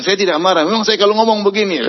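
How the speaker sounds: high indignant insistence; angry denial. Retold or imitated. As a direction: protest you always talk this way. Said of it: "Tapi dia bilang". (menyangkal dengan nada tinggi tersinggung)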